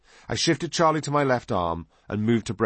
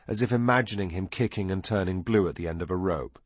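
ordinary delivery

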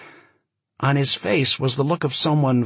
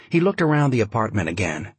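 ordinary delivery

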